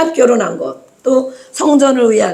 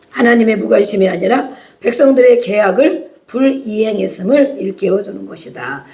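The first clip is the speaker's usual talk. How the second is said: normal talking voice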